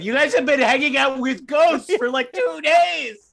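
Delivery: loud, high-pitched incredulous protest; exasperated outburst. Real, not acted. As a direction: raise the voice in comic exasperation. Mock exasperation at the party.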